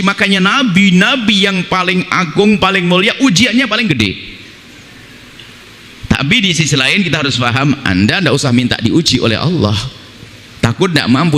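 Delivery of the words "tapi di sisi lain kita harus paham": usual speaking voice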